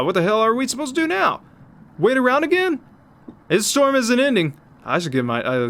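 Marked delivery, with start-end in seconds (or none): 0.0-4.6 high, alarmed panic; high panicked outburst. Acted. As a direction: panic at the endless storm. Character panicking.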